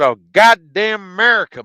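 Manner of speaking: loud, high exasperated outburst; retold angry comeback. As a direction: re-enact cutting someone off angrily. Retelling own angry comeback.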